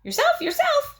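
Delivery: very high, loud squawk; bird repeating. A narrator's reading. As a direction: parrot it back sharply. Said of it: "he repeated".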